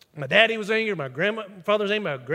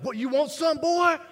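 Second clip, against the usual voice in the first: tough brother challenge; 7 semitones higher than usual. Retold or imitated. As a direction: square up like a hothead brother. Brother threatens with bat.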